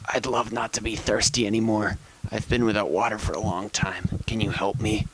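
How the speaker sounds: a touch of a dry, dizzy croak; weak croak. Acted. Parched man begging the hidden voice, weakly croaked. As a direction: plead weakly with a parched throat. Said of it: "he croaks again".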